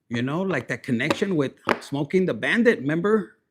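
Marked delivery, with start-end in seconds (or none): none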